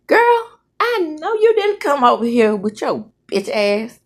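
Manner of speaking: put-on high, indignant opening on "Girl"; sassy confrontation. Woman confronting an unwelcome visitor, indignant. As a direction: call her out, starting high.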